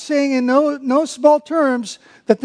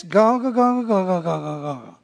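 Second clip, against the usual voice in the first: muttered ganga dropping lower and softer; grumbling noise; 4 semitones lower, 4 dB quieter than usual. Shown as a demonstration. Preacher imitates grumbling crowd, fading mutter. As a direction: grumble it away under your breath. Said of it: "a word that sounds like what it is".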